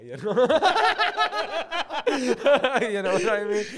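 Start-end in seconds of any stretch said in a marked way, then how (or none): 0.5-3.6 high squeal of laughter into the words; laughing. Real, not acted. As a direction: let the laugh break through the question. Laughing fit mid-bit.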